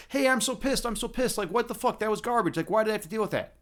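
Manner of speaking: hammed-up griping in a high voice; mock pissed-off. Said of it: "allow it to affect me and be like".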